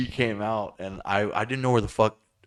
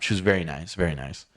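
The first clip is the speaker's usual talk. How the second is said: ordinary talk, nothing marked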